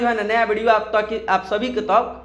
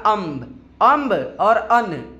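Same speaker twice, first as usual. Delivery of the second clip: Amb and अन said slowly, sound by sound; slow demo; much slower than usual. Shown as a demonstration. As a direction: draw out अम्ब और अन so pupils hear the difference. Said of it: "इन दोनों का उच्चारण".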